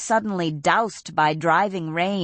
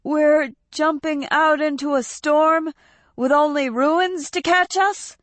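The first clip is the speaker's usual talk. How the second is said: put-on higher, alarmed young man; fearful cry; 11 semitones higher, 4 dB louder than usual. A narrator's reading. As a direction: panic rising in disbelief. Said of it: "he cried".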